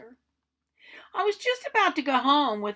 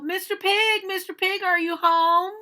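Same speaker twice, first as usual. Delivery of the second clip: high, loud sing-song call; 9 semitones higher, 7 dB louder than usual. Acted. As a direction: call the pig out of his brick house. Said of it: "I called".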